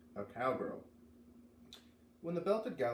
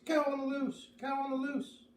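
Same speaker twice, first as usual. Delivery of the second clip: calling out; 12 semitones higher than usual. Acted. Crowd at the fair calling a warning. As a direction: shout the warning across the barn.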